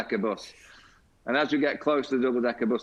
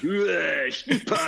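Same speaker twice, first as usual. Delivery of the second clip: a high, hooting "Whoa, Skipper!"; calling out; 7 semitones higher, much slower than usual. Retold or imitated. Flintoff spots his captain and calls across the room, hooting. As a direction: hail the captain from the far corner.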